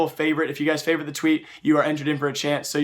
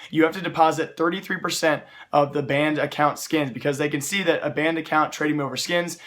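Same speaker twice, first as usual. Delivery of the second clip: ordinary delivery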